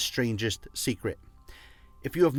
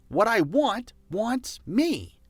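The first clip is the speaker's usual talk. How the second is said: What I want wants me lifted higher and drawn out slowly; higher, slower repeat; 7 semitones higher, slower than usual. Shown as a demonstration. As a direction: say it again, higher and slower with conviction. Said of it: "you can also say it like this".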